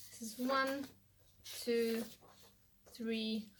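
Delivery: ordinary talk, nothing marked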